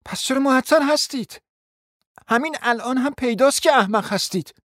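scornful answer to her jilted suitor; loud retort. A narrator's reading. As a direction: throw it back at him loudly. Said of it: "با صدای بلندی جواب داد".

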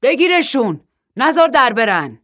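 loud, high shouted order; shouting. Acted. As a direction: yell to your sons to grab them. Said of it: "فریاد زد".

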